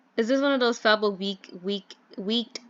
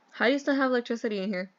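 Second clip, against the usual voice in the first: ordinary talk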